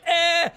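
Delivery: high-pitched grunt of pushing back; comic little noise of effort; mock straining grunt. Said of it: "and you're like".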